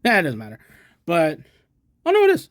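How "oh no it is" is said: sudden high yelp of surprise; startled squeal of discovery; surprised. Real, not acted. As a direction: realize it with a jolt, high and loud. Surprised to find matching scope.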